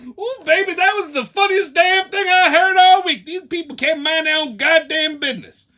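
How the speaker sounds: high, loud imitation of friend Charlene; hammed-up loud high friend voice; imitating Charlene. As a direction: play the friend cracking up at the scene.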